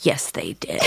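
very low, hushed stubborn reply; dead-serious insistence. Retold or imitated. Grandmother contradicts her family, low and dead serious. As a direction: drop right down, quietly adamant.

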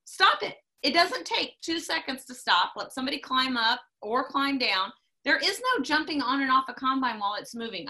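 high, loud, emphatic safety warning; exasperated scolding. Real, not acted. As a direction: lay down the law, exasperated.

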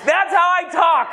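loud, slow mom's insistence; mock yell. Mom defends her volume, mock loud shout. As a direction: shout it like mom denying yelling. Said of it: "I'm not yelling. I'm Cuban".

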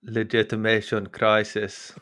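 slow, drawn-out South African accent on Legitimation Crisis; mock South African accent. Shown as a demonstration. Podcast host trying a German accent, lands South African. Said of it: "That's South African".

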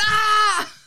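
loud mock yelp of pain, pitched high; cramp yelp. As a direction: yelp like a leg cramp just struck. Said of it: "He went".

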